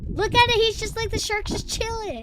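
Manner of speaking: high-pitched thrilled exclamation; excited delight. Real, not acted. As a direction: squeal it with delight. Delighted by shark on screen.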